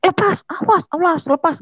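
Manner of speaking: high-pitched struggling cries; demanding release. Retold or imitated. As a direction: imitate a father struggling to break free. Ayah meronta minta dilepas. (menirukan bapak meronta dengan nada tinggi)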